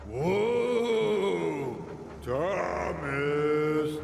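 loud, drawn-out ghostly wail; haunting, wailing tease; spooky voice. A narrator's reading. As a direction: call like a ghost, stretching the vowels. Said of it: "in a spooky voice".

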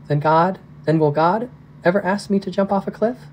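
put-on a child asking the big question; young girl. Little Missy asks her dad about God, lightly voiced worried child.

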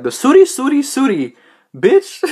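mock her pretending it's a real thing; hammed-up parody of a service worker; mocking imitation. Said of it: "She was all like, she's pretending".

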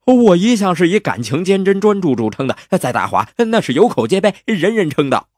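high, quick, emphatic self-defence; hammed-up indignant boasting; protesting hero.

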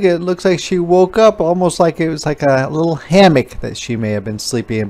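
usual speaking voice

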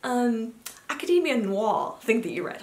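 high questioning mother's voice at first; mother asking. Retold or imitated. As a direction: play mom trying to remember a name. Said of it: "my mother messaged me the other day and she was like".

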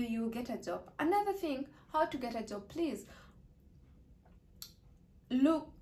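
ordinary talk, nothing marked

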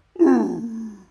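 loud, high mock-excited gasp; exaggerated intake of breath; theatrical gasp. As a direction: gasp hugely in pretend amazement. Mock excitement over audiobook news.

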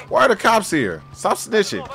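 ordinary talk, nothing marked

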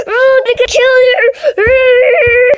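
long, loud, high play scream; hammed-up roar. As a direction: roar a drawn-out pretend attack. Mock attack in plushie play.

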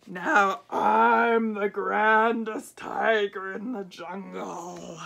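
slow, loud, drawn-out boast; grand tiger boast. A narrator's reading. Tiger walking off boasting about the shoes, slow and loud. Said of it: "went away saying".

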